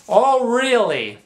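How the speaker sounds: mock the book like you don't buy it; sarcastic disbelief. Mocking the picture book's claims, slow sarcastic disbelief.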